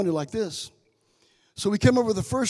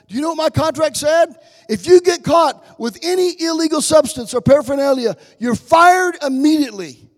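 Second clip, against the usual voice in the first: loud, raised preacher's voice; full-force preaching; 4 semitones higher, 8 dB louder than usual. Real, not acted. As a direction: drive each point home to the congregation.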